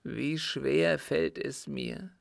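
put-on halting, laboured confession of a guilty old man; agonised appeal to his son, weighted with guilt; anguished dying father. Sick father confessing to his son Arthur, anguished.

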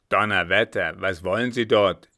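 loud, gruff exclamation; startled curse. A narrator's reading. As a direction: burst out with it, baffled. Officer curses on hearing the news. (lauter, verblüffter Ausruf)

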